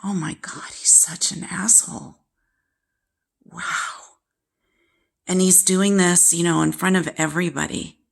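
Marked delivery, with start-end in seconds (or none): 0.0-3.6 whispered aside, almost no voice. Retold or imitated. Daughter muttering about her father to herself, whispered. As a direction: breathe it out in shock under your breath. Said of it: "I was like".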